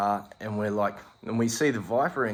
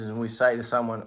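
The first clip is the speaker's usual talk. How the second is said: ordinary talk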